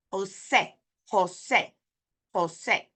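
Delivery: Jose short, ending in pure "seh"; Spanish pronunciation. Shown as a demonstration. Teacher repeating the right Jose, correct Spanish sounds. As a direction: stress the last syllable of Jose, no glide. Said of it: "It's not Jose".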